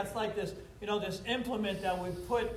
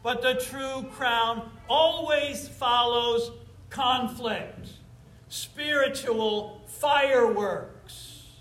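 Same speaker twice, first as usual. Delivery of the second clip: slow, raised, ringing preacher's cadence; preaching at full force; 6 semitones higher, 5 dB louder, much slower than usual. Acted. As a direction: proclaim it slowly from the pulpit. Preacher building to a climax.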